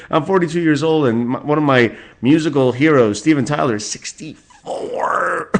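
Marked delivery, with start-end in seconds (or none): 3.7-5.5 high, slow and drawn out; stunned disbelief. Real, not acted. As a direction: let the number hang in disbelief. Third repeat of hero's age.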